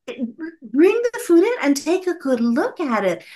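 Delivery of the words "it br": normal talking voice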